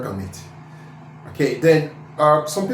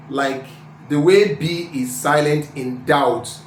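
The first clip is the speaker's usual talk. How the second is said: usual speaking voice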